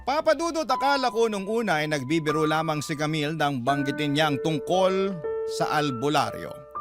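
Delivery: usual speaking voice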